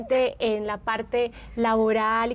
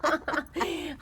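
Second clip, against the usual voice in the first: real laugh through the words; 8 semitones higher than usual. Real, not acted. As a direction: laugh out your answer, high and amused. Laughing agreement after a joke. (riendo de verdad)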